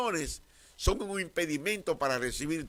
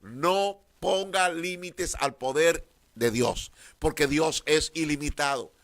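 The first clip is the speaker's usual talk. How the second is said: slower, weighty, each word pressed; repeated louder and slower for emphasis; slow emphatic repeat; 4 dB louder, slower than usual. Real, not acted. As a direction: drive it home slowly. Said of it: "Vuelvo y lo repito".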